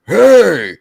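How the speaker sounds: loud, high warning yell into the dark; shout to scare. Retold or imitated. Yelling into a dark backyard to scare an intruder, loud shout. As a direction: bark it out to scare off whoever is there. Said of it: "trying to scare whoever's out there".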